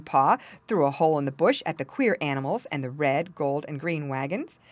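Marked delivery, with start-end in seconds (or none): none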